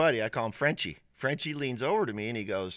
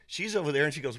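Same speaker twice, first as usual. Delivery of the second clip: normal talking voice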